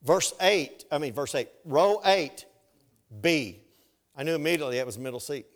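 usual speaking voice

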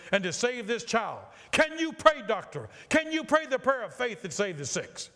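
building, fervent outcry that climbs high; impassioned rebuke. Acted. Pastor challenging a doctor to pray for a dying child, impassioned. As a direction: drive it upward into an urgent challenge. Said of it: "You are too much excited".

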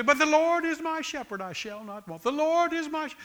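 mock a complainer reciting in a whine; whiny mock quote. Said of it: "And then they'd go back and say".

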